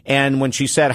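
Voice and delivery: ordinary talk, nothing marked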